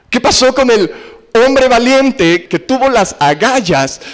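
preacher pressing the congregation with repeated questions; preaching rhetoric. Real, not acted. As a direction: hammer the repeated question, rising. Rhetorical questions at full force.

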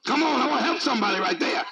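ordinary talk

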